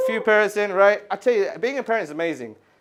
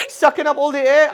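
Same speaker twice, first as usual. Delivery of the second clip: high, loud exasperated question; mock incredulity; 7 semitones higher, 6 dB louder than usual. Customer mocking the pricier dehumidifier, incredulous. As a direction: push it up in comic disbelief. Acts out baffled reaction to saleswoman.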